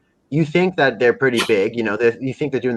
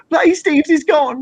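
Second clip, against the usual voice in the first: very high, drawn-out mock wail; hammed-up English-accented wailing; mock crying; 4 dB louder than usual. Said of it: "they're all sitting around crying".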